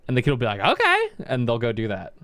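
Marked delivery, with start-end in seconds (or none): 0.6-1.1 very high, loud eager "Okay"; eager kid. Retold or imitated. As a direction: chirp it like a keen little kid. Kid agreeing to vendor.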